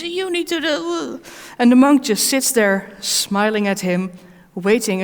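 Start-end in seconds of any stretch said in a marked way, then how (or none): 0.0-1.2 high, drawn-out imitation of an angry rant; mock angry shouting. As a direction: act out a tirade without the volume. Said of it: "the guy starts shouting at the monk".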